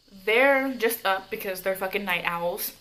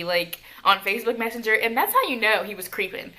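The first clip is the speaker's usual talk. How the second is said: ordinary delivery, nothing marked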